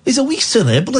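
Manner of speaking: put-on Scottish voice, mocking; Scottish-accented mockery of a self-important comedian. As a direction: sneer it in an imitation Scottish accent. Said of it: "Don't do the voice".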